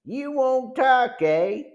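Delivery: high, drawn-out threatening taunt; slow, menacing gang leader; ugly menace. A narrator's reading. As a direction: turn nasty on the silent captive. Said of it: "The leader's voice grew ugly".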